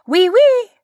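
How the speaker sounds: high, loud enthusiastic French oui oui; eager fake French. A narrator's reading. Waitress answering the stranger in eager fake French. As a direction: chirp it like an eager French waiter. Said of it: "nodding enthusiastically".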